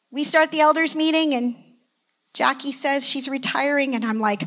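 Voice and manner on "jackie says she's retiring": ordinary talk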